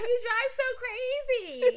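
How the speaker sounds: high, slow mock-whiny mom through laughter; complaining mom. Mom scolding her son about his driving, high slow mock whine. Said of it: "She's like".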